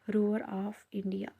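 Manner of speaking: "roar of India" drawn out very slowly; slow, deliberate repeat of "roar of India"; slow dictation. Real, not acted. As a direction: say "roar of India" word by word, stretched for note-takers. Teacher dictates name after spelling it.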